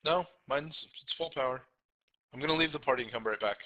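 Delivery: ordinary talk, nothing marked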